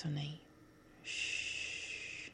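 ordinary talk

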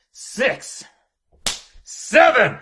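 loud, high punched count; shouted count. Acted. Boss counting out a punishment to employee, sharp shout. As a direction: call each number out hard.

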